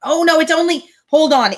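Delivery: ordinary delivery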